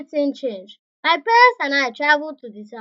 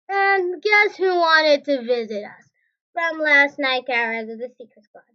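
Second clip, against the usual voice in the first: usual speaking voice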